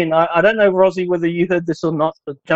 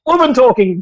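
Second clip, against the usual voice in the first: mock announcement; 4 semitones higher, 3 dB louder than usual. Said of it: "so we can be like".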